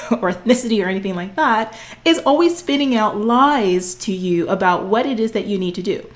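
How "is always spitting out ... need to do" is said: ordinary talk, nothing marked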